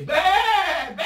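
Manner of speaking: comic angry-sheep bleat. Acted. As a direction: bleat your anger like a ewe. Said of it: "Il a la voix d'une brebis".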